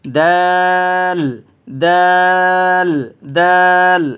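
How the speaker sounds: দাল high, loud and held very long, the tongue tip on the teeth; long dal. Shown as a demonstration. Teacher to students, a long loud দাল. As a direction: stretch দাল over three beats. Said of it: "উচ্চারণ করে আমি শোনাচ্ছি".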